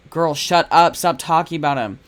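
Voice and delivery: loud half-joking shout at the cat; mock yell. Real, not acted. Streamer yelling at the game cat, loud playful shout. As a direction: tell her off loudly, playfully.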